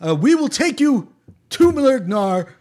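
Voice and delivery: emphatic, halting stump-speech captain; Bernie Sanders impression. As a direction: lecture the passengers like Bernie Sanders. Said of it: "this Bernie Sanders guy".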